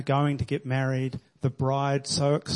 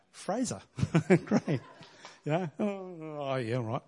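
mock sarcasm; 2 semitones higher, 5 dB quieter, slower than usual. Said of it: "Jesus is in heaven going".

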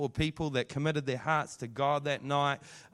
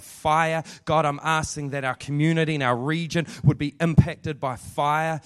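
louder, pleading prayer for fire; building congregational prayer, louder on fire; fervent prayer; 5 dB louder than usual. Acted. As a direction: pray it out with rising intensity.

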